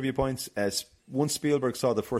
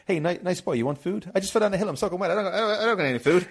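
Nedry pleading with dinosaur; 8 semitones higher, faster than usual. Retold or imitated. Nedry sweet-talks the dinosaur, high and pleading. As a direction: coax the dinosaur like a frantic petulant man.

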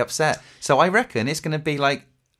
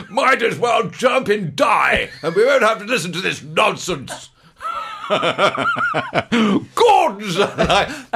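slow, much higher booming shout through laughter; Brian Blessed shout; 6 semitones higher, much slower than usual. Retold or imitated. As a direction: bellow it like a theatrical giant, laughing. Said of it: "You want to make Brian Blessed".

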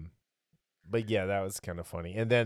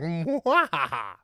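sneering panto villain; 6 semitones higher, 6 dB louder, slower than usual. Retold or imitated. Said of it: "he's just like".

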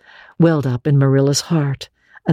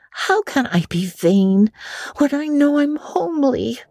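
Anne protesting; 12 semitones higher than usual. Acted. Anne protests to Marilla, high girlish voice. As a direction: plead your case, wide-eyed. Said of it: "protested Anne".

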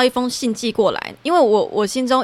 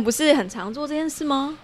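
ordinary talk

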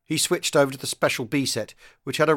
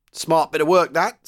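louder, higher hearty approval; approving; 5 semitones higher, 7 dB louder than usual. A narrator's reading. Sergeant major to the young commander, approving. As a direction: give brisk praise to a junior. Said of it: "said the sergeant major approvingly".

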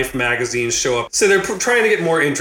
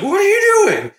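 high-pitched, incredulous mock shout; retold yelling; 4 dB louder than usual. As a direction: yell it as an exasperated coach, hammed up. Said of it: "yelling at her".

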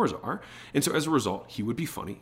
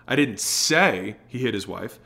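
slow down and land on SAY; stress on SAY; slower than usual. Shown as a demonstration. Sales coach shows how emphasis changes meaning, stress on SAY. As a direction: SAY drawn out and punched. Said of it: "the emphasis that we put on the words".

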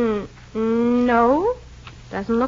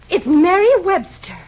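excited recognition; 6 semitones higher, 5 dB louder than usual. Acted. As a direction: exclaim the name. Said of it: "with an exclamation point after it".

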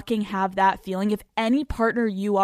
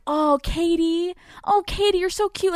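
patronizing mock voice; 9 semitones higher than usual. Host mimics how the ballplayer talked down to her, high patronizing coo. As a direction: coo it like you're patting her on the head. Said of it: "That's how the Red Sox player talked to me".

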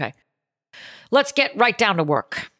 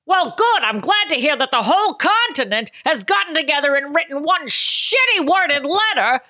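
furious Ann Landers; 8 semitones higher than usual. Acted. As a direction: rage at the whole continent.

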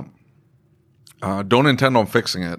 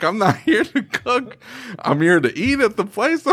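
high-pitched protest through laughter; laughing mock protest; 12 semitones higher, slower than usual. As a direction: retell the indignant reply, cracking up. Laughing retelling of own protest.

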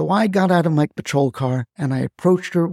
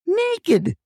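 high shouted Naked!; imitating grandma's high yell; 12 semitones higher than usual. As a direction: holler Naked! up high, dropping at the end. Caller repeats grandma's yell.

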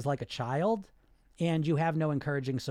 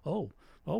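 cowed inner voice; 6 dB quieter than usual. Acted. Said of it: "And this voice goes".

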